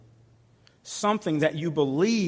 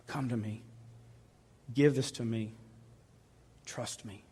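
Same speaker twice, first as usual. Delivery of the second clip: soft, gentle invitation; soft tender call; 3 semitones lower, 9 dB quieter than usual. Acted. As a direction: call them in quietly. God voiced to laid-off worker.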